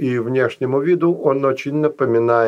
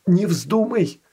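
предупреждение; 8 semitones higher than usual. A narrator's reading. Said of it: "предупредил Филат".

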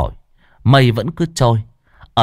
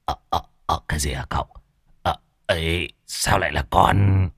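softer, lower, frightened stammering; startled stammer; 5 semitones lower, 5 dB quieter than usual. A narrator's reading. Lâm Sẹo startled when the master calls his name, stammering. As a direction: stammer like you've just been caught. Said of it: "giật mình, đưa tay ôm ngực".

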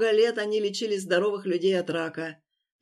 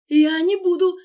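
high panicked refusal, louder; terrified; 10 semitones higher, 9 dB louder than usual. Acted. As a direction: cry out in panic. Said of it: "затрясся он в немом испуге".

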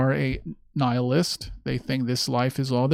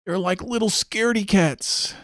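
mocking taunt; 7 semitones higher than usual. Real, not acted. Mocking scared people.

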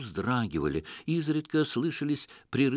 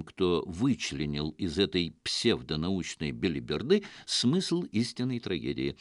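ordinary delivery, nothing marked

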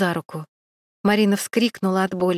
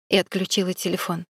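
ordinary delivery

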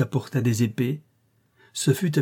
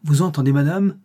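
voix qui gronde; 3 semitones higher, 4 dB louder than usual. A narrator's reading. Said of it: "l'affreuse voix gronda plus fort".

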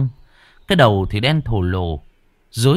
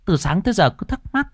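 high, fast village mother; 11 semitones higher, faster than usual. Acted. Mother explaining aloud to the family. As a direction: chatter like an old mother.

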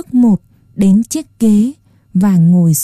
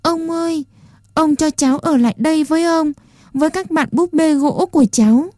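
put-on higher, much softer pleading child; begging; 6 semitones higher, 3 dB quieter, faster than usual. A narrator's reading. Puppet boy clutches the old man's hand, begging. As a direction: beg him with a small trembling voice. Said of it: "nói như van xin".

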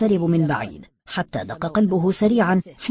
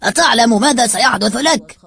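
loud, raised-pitch threat; angry; 5 semitones higher, 5 dB louder than usual. A narrator's reading. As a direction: challenge him angrily. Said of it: "فقال الرجل غاضبا".

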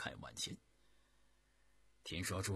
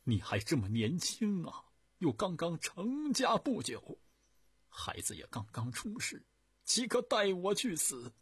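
tearful plea; 11 semitones higher, 7 dB louder than usual. A narrator's reading. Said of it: "含泪说".

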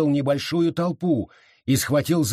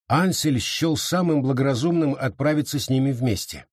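ordinary delivery

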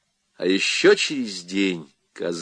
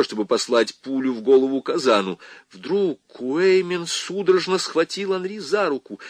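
plain reading voice

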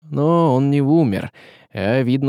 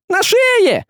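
very high screechy squeal; frantic squeal; 4 dB louder than usual. A narrator's reading. Хохлик spots the knife on the sorcerer, frantic squealing. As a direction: squeal the alarm in a tiny high voice. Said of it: "заверещал Хохлик".

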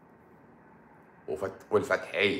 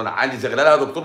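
a quick, higher, louder mock patient complaining; imitating a patient telling the doctor about blurry eyes; 4 semitones higher, 7 dB louder, faster than usual. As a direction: rattle it off like a fussy patient.

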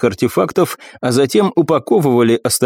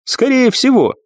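commanding warrior goddess; 12 semitones higher than usual. Acted. Nephrite voiced, higher.

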